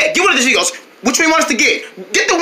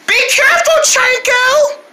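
high worried call after him; 11 semitones higher than usual. Acted. Friend calling after Chanko leaving to rescue, high call.

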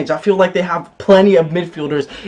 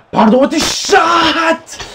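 excited call; 9 semitones higher, much slower than usual. Real, not acted. Live match commentary.

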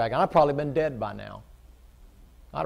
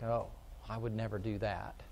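soft, prissy imitation of a holier-than-thou churchgoer; mock their snooty disdain, quietly; mock self-righteous; 3 semitones lower, 10 dB quieter than usual. Preacher mimicking a snooty churchgoer, softly mocking. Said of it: "that hierarchy kind of an attitude".

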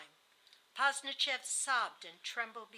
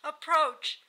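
higher, louder insistent bidding; urgent sister; 6 semitones higher, 8 dB louder than usual. A narrator's reading. Sister urging the narrator to approach the deathbed, urgent. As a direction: call them over in a raised voice. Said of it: "said her sister".

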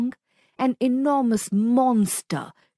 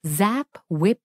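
usual speaking voice

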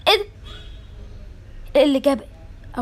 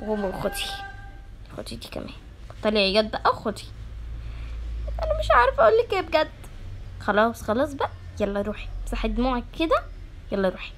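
soft soothing; 10 semitones lower, 12 dB quieter than usual. Acted. Teacher soothing crying girl.

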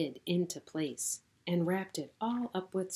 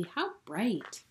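usual speaking voice

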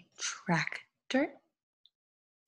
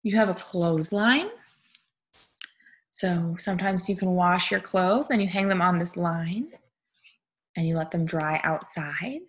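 ordinary talk

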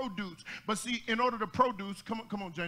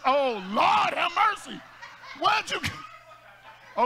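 loud cry out; 6 semitones higher, 5 dB louder, much slower than usual. Real, not acted. Preacher cries out, loud.

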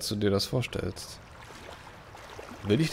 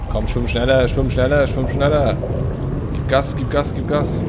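loud urging swim faster; urgent cheering; 5 dB louder than usual. Real, not acted. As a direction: push the player on, loud.

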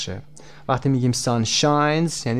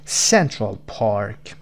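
"Central Park", very slow, leaning on "Cen"; stress on CEN; 3 semitones lower, much slower than usual. Shown as a demonstration. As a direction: stretch out "Central Park" with the first syllable of "Central" strongest. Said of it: "قسمت سنت".

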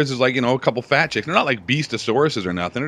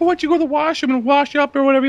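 woman's voice; 14 semitones higher, 4 dB louder, faster than usual. Retold or imitated. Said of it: "She's like".

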